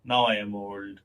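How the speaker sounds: slightly low-pitched imitation of a complainer; mock moaning. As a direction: lightly imitate someone moaning about age. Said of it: "always crying about getting old".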